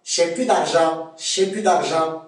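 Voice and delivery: "J'ai plus" contracted to "ché pu"; Québécois "plus" without the l in "J'ai plus d'argent"; Québécois pronunciation. Shown as a demonstration. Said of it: "on va dire quoi?".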